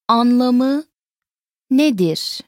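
"Anlamı" rising, pause, "nedir" falling; word by word. Shown as a demonstration. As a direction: separate "Anlamı" from "nedir" for learners. Teacher repeats it word by word.

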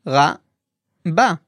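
Ra-ba, each syllable said apart; split into syllables. Shown as a demonstration. Teacher breaking Raba into syllables, careful and slow. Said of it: "break it down by syllable".

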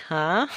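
HUH gliding up like a question; rising tone. Shown as a demonstration. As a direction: ask "huh?" with a steady rise. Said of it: "All that same rising tone".

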